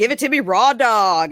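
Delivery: loud, brassy imitation; hammed-up cocky line; mock brash quote. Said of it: "When she's like".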